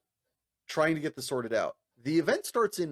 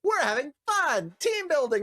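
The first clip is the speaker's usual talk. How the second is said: fun morning guy; 12 semitones higher, 4 dB louder than usual. Retold or imitated. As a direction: lay on the team spirit. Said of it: "this clash of energy".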